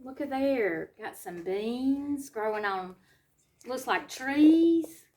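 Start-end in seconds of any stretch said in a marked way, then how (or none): none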